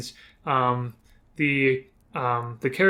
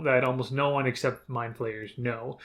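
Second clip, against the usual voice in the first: ordinary delivery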